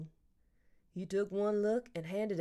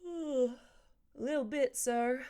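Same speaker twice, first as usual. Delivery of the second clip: a touch of put-on drowsiness, drawn out; pretend sleepy; 6 semitones higher, slower than usual. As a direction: fake being half asleep to dodge questions. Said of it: "I could pretend I was falling asleep".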